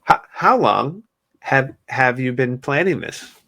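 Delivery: drawn-out sarcastic quote; slow mocking reread. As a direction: stretch it out like a planted question. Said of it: "what are they, wearing a wire?".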